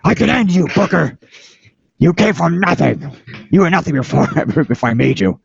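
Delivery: high, far-off mock yell of an angry boss; hammed-up boss yelling down the headset; angry Vince impression. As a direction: ham up the boss screaming into the headset. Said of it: "what Vince was screaming".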